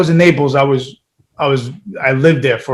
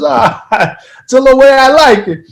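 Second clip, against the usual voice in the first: put-on laughing Italian-American accent, higher and louder; Italian dad accent; 9 semitones higher, 4 dB louder than usual. Son imitating his Italian dad defending frozen bread in accent. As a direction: mimic the old Italian father shrugging it off. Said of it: "you nailed the accent too".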